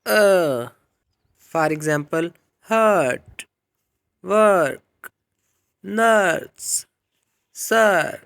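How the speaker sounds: teacher demo of long er, loud; long er words. Shown as a demonstration. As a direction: stretch the er in नर्स. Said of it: "पांचवें number पे मोनोफ्थोंग long vowel sound है".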